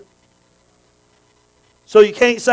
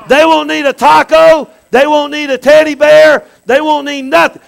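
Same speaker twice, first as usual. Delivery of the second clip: loud, high, emphatic shouting; preaching at full force; 8 semitones higher, 11 dB louder than usual. Real, not acted. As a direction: drive each phrase home to the congregation. Preacher drives home his punchline.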